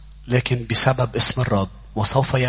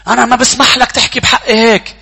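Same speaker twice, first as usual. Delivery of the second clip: offended outburst; 10 semitones higher, 8 dB louder than usual. Acted. Voicing an offended believer.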